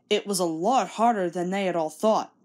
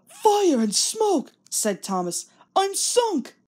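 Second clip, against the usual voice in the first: much higher, slow wail of dismay; dismayed cry; 10 semitones higher, slower than usual. Acted. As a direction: groan it as you sink. Thomas falls down the mine.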